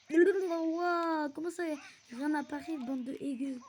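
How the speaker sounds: loud, high, incredulous yell; baffled outburst. Real, not acted. Gamer repeating their own garbled words, baffled shout. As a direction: blurt it back loudly in disbelief.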